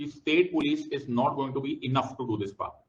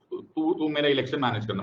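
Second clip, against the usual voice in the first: usual speaking voice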